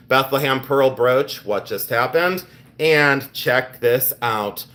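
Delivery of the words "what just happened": usual speaking voice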